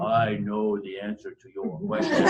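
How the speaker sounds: imitating a heavy German accent; thick German accent. Colleague approaching the speaker after the talk, in a thick German accent. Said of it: "said in a thick German accent".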